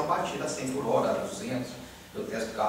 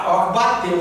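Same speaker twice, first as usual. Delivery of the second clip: agitated retelling; 6 semitones higher, 10 dB louder than usual. Retold or imitated. Reliving the crash moment.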